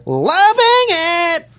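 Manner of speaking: high, slow, drawn-out mock yell of loving it; drunk fan scream. Wasted girls at the Hollywood Bowl yelling after songs, high drawn-out mock scream. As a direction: stretch it out like a tipsy concertgoer. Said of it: "And I would hear".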